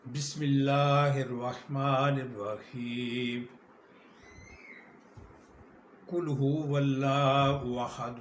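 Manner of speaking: slow, measured Arabic with each word drawn out; slow correct Ikhlas recitation; careful tajweed recitation. Shown as a demonstration. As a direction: recite deliberately, giving every letter its due. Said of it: "جس طرح اللہ پاک نے فرمایا ہے۔".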